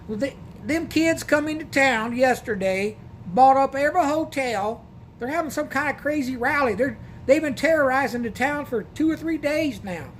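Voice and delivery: a hint of a fed-up small-town clerk; motel desk lady. Motel lady voiced in retold story.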